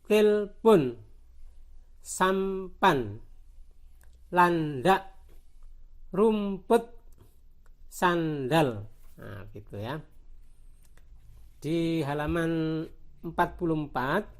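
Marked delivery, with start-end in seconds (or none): 0.0-8.9 words split into clear syllables; teacher's word-list demo; syllable-by-syllable reading. Shown as a demonstration. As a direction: read out each word slowly with a gap between syllables. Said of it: "tulisannya dibaca".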